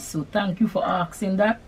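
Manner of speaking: ordinary talk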